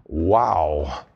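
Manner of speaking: low, hushed wow of amazement; awed wow. Real, not acted. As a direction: let the wonder sink in low. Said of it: "I say again".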